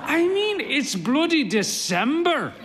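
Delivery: high indignant outburst; indignant son. A narrator's reading. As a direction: pitch it up in mock outrage. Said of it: "he exclaims".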